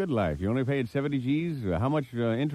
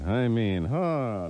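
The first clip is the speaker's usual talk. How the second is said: ordinary delivery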